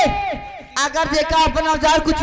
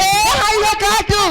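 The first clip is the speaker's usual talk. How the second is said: shout; 5 semitones higher than usual. Pretend. Shouted call as goddess.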